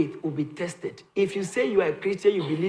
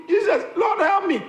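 screamed plea; 9 semitones higher than usual. Retold or imitated. Peter to Jesus, high shouted plea for help. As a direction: cry out like a sinking man. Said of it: "Peter screamed".

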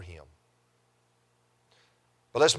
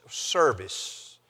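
usual speaking voice

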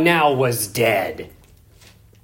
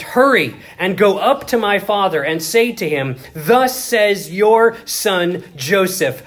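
preaching at full force; 3 semitones higher than usual. Acted. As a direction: proclaim Joseph's words to the congregation.

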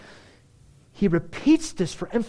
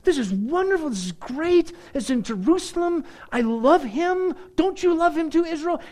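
much higher, louder, giddy joy; excited David; 11 semitones higher, 4 dB louder than usual. Retold or imitated. David gushing to Israel, high and excited. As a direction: gush like a thrilled child. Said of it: "And he was like".

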